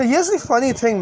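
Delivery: ordinary delivery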